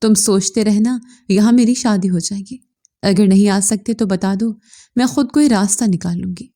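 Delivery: ordinary delivery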